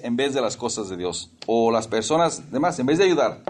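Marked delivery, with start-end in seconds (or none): none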